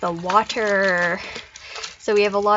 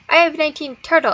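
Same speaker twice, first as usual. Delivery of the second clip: put-on child's error; 7 semitones higher, 6 dB louder, faster than usual. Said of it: "next time they say".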